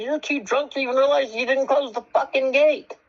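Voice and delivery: indignant, high-pitched accusation; retold heated argument. Man tells drunk neighbor she left the gate open, retold heated argument. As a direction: re-enact the exasperated comeback, voice pitched high.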